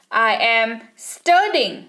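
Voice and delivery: slow, careful model sentence; slow dictation. Shown as a demonstration. Teacher dictating to students, slow. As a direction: let every word land slowly. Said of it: "teacher repeats model sentence".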